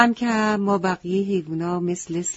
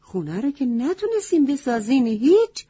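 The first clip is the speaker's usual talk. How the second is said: higher, louder, reproachful goat voice; scolding wise goat; 4 semitones higher, 5 dB louder than usual. Acted. Wise goat scolds the animals for sitting and sulking, loud and high. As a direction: scold them sharply like a stern old goat.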